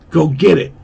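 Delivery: lightly acted nervous order; nervous urging. A narrator's reading. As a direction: urge a friend to go, a bit nervously. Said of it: "he was a bit nervous".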